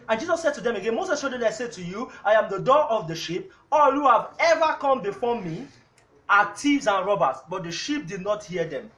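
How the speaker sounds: loud, fast sermon reading of the verse; preaching scripture reading. Real, not acted. Pastor reading the verse to the congregation, preaching loud. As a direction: boom out the scripture to the church. Reads scripture at full force.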